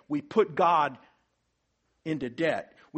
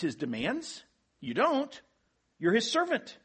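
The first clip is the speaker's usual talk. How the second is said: raised, high, insistent preaching; rising pulpit fervour; preaching at full force; 5 semitones higher, 3 dB louder than usual. Acted. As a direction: drive the point home to the congregation. Preacher presses the obedience point.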